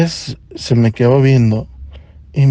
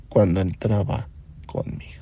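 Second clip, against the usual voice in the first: hushed gossipy whisper, much softer; 5 semitones lower, 10 dB quieter than usual. Real, not acted. Telling friends the doctor got nervous around him, whispered. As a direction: lean in and whisper the secret.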